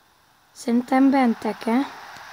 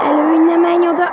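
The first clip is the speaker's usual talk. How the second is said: loud, fast, high urging; urgent shout; 5 semitones higher, 6 dB louder, much faster than usual. Real, not acted. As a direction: yell at the player to stop. Yelling at game.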